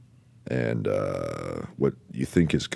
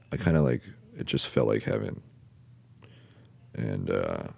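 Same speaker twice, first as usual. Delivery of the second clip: ordinary talk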